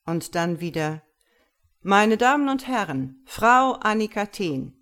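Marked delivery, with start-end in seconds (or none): 1.8-4.7 high, loud showman's announcement; stage announcement. A narrator's reading. Magician presents his volunteer to the audience, loud announcement. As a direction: present her to the whole hall. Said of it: "rief der Zauberkünstler".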